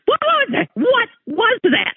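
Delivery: 3 a shocked double take, slowing down; 1 alarmed question. Retold or imitated. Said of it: "And I thought".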